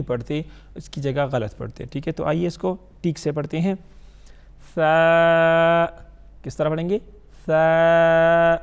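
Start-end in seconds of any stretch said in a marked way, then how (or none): 4.7-5.9 long, loud soft ثا, held on one note. Shown as a demonstration. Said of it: "نرم حرف ہے". 7.5-8.6 long, loud soft ثا, held on one note. Shown as a demonstration. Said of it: "نرم حرف ہے".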